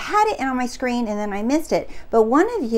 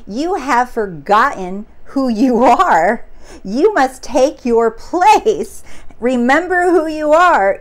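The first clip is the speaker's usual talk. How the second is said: slow, louder and grave, like a voice from the clouds; solemn father's voice; 5 dB louder than usual. Retold or imitated. The dead king telling his lost son to remember, slow and booming. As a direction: intone it slowly as the spirit of a dead king.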